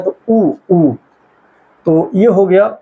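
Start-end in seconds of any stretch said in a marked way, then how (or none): 0.0-0.9 उ said with rounded lips; vowel demo. Shown as a demonstration. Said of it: "ओठ जो है वृत्ताकार में बन रहा है". (उ को होंठ गोल करके बोलना)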